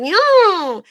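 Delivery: high, loud drawn-out "yeah"; hammed-up high cheer; mock cheering. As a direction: imitate an excited groom whooping. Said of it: "he's all like".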